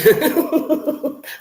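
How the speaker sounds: sudden high, loud burst of laughter; loud laughing burst; big laugh. Real, not acted. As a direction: really crack up at the chat. Reading chat, laughs out loud.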